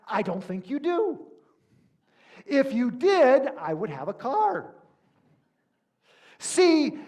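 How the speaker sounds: an indignant customer scolding the rental clerk; really agitated Seinfeld. Retold or imitated. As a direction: lecture the clerk in rising frustration. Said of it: "Seinfeld gets really agitated".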